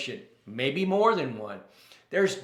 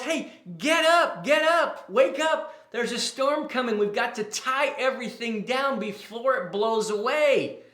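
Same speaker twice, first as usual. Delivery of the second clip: high, raised wake-up yell; urgent yell; 8 semitones higher than usual. Retold or imitated. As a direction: yell in alarm to rouse a sleeper. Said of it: "he shakes him awake and he yells".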